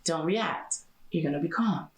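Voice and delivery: soft, low pep talk to herself; calm self-talk. Retold or imitated. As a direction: quietly talk yourself into calm. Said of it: "I be like".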